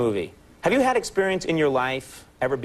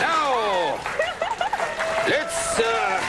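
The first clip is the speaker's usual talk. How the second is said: mock leprechaun; 12 semitones higher, much slower than usual. Continues leprechaun voice.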